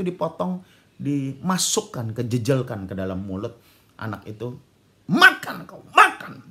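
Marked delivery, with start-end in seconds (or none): 5.1-6.5 very high, loud shouted order; angry father. Retold or imitated. Father forcing food into son's mouth, furious shout. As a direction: bellow it as the enraged dad. Father forces son to eat ear.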